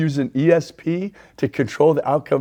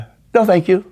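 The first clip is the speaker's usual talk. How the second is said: polite refusal; 7 semitones higher, 5 dB louder, faster than usual. Retold or imitated. Acting out turning down offer.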